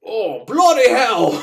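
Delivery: high, slow shocked British cry; hammed-up British guard gasping; British exclamation. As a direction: blurt it like a startled English guard. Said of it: "he's like".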